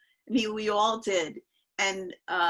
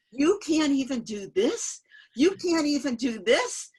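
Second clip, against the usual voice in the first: louder, higher, rising disbelief; retold incredulity; 5 semitones higher, 6 dB louder than usual. Guru challenging a devotee who won't promise, retold incredulity.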